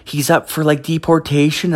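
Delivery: a hint of a gossiping young woman; imitating girlfriend's friend. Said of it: "She's like".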